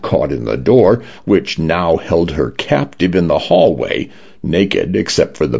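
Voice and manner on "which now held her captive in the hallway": ordinary delivery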